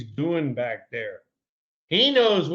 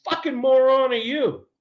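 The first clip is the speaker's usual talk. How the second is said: high, furious mock yell at the pitcher; retold furious yell; 11 semitones higher, 3 dB louder than usual. Player retelling how he yelled at the young pitcher, mock furious. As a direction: snap it out high, retelling the fury. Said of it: "I said".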